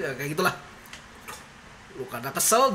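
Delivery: usual speaking voice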